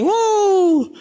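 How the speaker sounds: high, loud wow; exclamation. Real, not acted. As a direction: gasp out an amazed wow. Preacher exclaims loudly.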